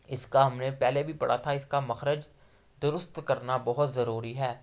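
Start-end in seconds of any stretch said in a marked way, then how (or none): none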